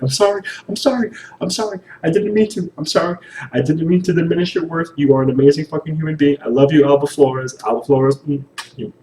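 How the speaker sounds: high, fast mock-pleading sorries; groveling apology. Fan apologizing to Alba Flores in a high, hammed-up plea. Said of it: "And I was like".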